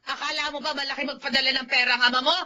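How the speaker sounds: usual speaking voice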